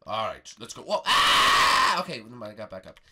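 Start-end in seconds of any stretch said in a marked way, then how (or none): 1.1-2.0 loud, harsh yell; falling scream. Pretend. Bart falls.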